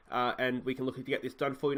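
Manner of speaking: normal talking voice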